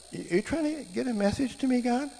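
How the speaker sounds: acted high-voiced question to God; put-on high, questioning voice; high-pitched query. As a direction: pitch it way up, asking heaven.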